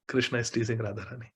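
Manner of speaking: ordinary talk, nothing marked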